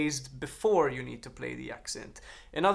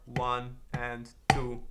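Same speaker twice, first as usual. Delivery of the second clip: very slow, drawn-out counting; 2 semitones lower, much slower than usual. Shown as a demonstration. Teacher checks the hand choreography, counting slowly. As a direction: count each beat slowly along with the sticks. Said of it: "ready again down here to start".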